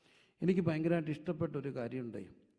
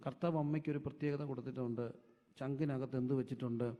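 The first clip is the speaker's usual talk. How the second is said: ordinary delivery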